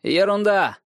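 high, brushing it off; dismissive dwarf. Acted. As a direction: wave the objection away. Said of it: "возразил Ланнистер". (пренебрежительно, голосом карлика)

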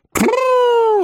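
very high trilled "prrr" to halt a horse; high rolled whoa. A narrator's reading. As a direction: cry out a rolled horse-stopping call. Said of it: "воскликнул сей джентльмен". (высокий возглас «тпру»)

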